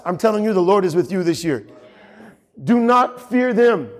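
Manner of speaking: ordinary talk, nothing marked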